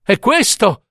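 high-pitched cry of recognition. A narrator's reading. Mother recognises her child's heart among the flowers, crying out. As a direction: call it out in sudden recognition. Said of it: "gridò".

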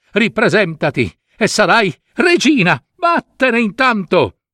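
king banishing his daughter, angry shout. Acted. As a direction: drive her out of your sight.

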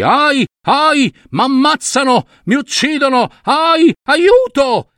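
high-pitched wails of someone being attacked; storybook victim yelping for rescue; cries for help. Acted. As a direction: cry out in pain and panic.

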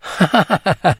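high, light staccato laugh; acted laugh. A narrator's reading. A friend amused by Simpson's lofty comparison, acted high laugh.

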